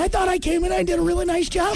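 high falsetto Luke line; squeaky mock actor. Host mocking the actor in high falsetto. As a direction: say it like a squeaky wimp.

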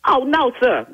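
ordinary delivery

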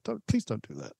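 soft, pained aside; muted plea. Retold or imitated. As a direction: quietly beg them to stop. Voices own reaction to fake accents.

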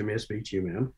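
small, soft, nervous voice; timid. Retold or imitated. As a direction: ask meekly, barely audible. Timid request to boss.

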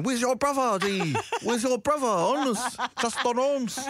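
high-pitched, hammed-up stranger's voice; imitating a stranger. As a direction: mimic the guy, pitched well up. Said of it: "this guy is out here going".

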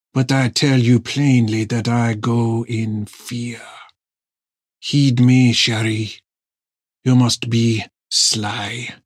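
slow, grave and fearful; a lightly voiced old sailor warning; slow solemn pleading voice. A narrator's reading.

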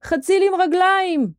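high, amazed cry; shout. A narrator's reading. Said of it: "צעק מישהו". (צעקת תדהמה גבוהה)

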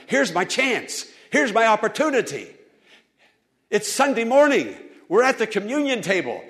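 eager. Retold or imitated. Blind man realising his chance, rising excitement. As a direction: seize the moment. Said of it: "I heard about him".

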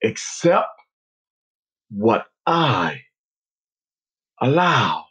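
slow, firm, measured words; strong voice. Retold or imitated. Unseen voice repeats its message to player, strongly. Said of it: "heard the words again, strongly".

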